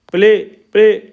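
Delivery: "प्ले" twice, loud, with a long ए; long ए vowel. Shown as a demonstration. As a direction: call out "प्ले" as a drill word, vowel lengthened. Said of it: "ए का उच्चारण लंबा कर दिया जाता है". (ज़ोर से "प्ले", ए लंबा करके)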